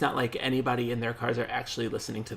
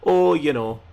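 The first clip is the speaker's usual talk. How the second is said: loud mock-Canadian accent; 3 semitones higher, 9 dB louder than usual. Shown as a demonstration. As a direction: lean hard into a Canadian accent. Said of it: "Canada or something".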